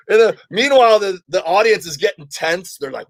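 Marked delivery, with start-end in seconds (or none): none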